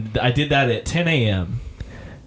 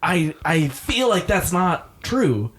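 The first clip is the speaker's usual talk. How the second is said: skeptical; 6 semitones higher, slower than usual. Retold or imitated. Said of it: "And I was like".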